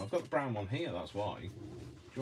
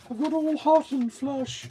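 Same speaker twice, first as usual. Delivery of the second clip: George voice; 7 dB louder than usual. Retold or imitated. Crafter complaining of the heat, silly high voice. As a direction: squeak it out in the silly character voice.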